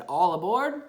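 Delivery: a lively call of all aboard. A narrator's reading. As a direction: call everyone onto the ark. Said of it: "God said".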